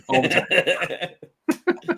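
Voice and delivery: drawn out through laughter; laughing. Real, not acted. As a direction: laugh the words out slowly. Laughing reply to joke.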